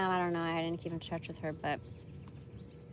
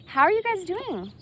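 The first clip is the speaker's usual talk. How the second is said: loud, bright hello to the viewers; excited greeting; 10 semitones higher, 7 dB louder than usual. Real, not acted. Greeting her viewers after a tangent, loud and high. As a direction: burst in with a high, eager greeting.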